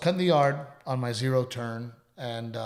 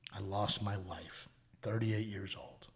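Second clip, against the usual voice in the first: slow, softer, trailing into a whisper; hushed grief; 4 semitones lower, 11 dB quieter than usual. Retold or imitated. Quoting the grieving widower to the co-host, slow hushed whisper. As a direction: let the words drop to a whisper. Retelling man's loss, voice breaks.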